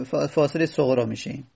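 normal talking voice

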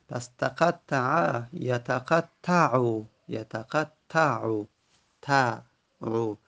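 یتقطعو drawn out, the ending تعو isolated; slow Arabic forms. Shown as a demonstration. Teacher showing students where the fatha falls, slow. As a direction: stretch یتقطعو and then repeat تعو slowly. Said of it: "teacher spells out present form".

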